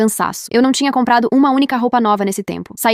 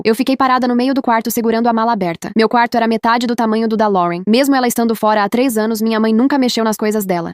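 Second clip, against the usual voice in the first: ordinary delivery, nothing marked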